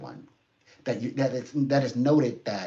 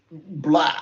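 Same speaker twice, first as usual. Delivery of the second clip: mock blurt; 4 semitones higher, 9 dB louder than usual. Mimics blurting words out.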